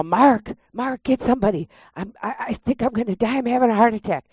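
high, urgent plea for help; retold panic. Retelling how she begged Mark for help, panicky and high. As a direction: beg him to get someone, scared. Begging husband for help, heart attack.

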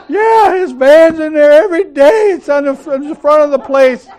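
high, loud tattling; mock scandalized. Churchgoer telling pastor of lounge visits, mock scandalized. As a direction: imitate a gossip breaking shocking news. Tattler insisting with her evidence.

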